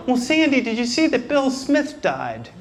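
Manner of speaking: casual gossip in a mom voice; mom's voice. Retold or imitated. Mom changing the subject to gossip, lightly voiced. Parents bickering in a scene.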